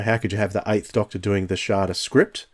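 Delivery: ordinary talk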